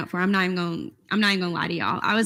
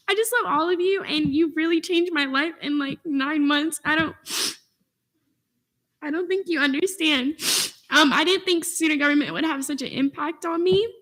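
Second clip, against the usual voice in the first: real tearful gratitude, pitched higher; emotional; 5 semitones higher than usual. Real, not acted. Student senator thanks colleagues who changed their life, emotional. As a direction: thank them while fighting back tears, voice high. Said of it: "I don't know why I'm being emotional".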